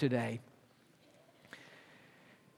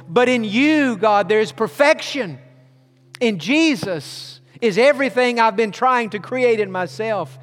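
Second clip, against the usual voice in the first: louder, impassioned praying; fervent prayer; 5 semitones higher, 4 dB louder than usual. Acted. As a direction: cry out to God with conviction. Prayer rising to fervour.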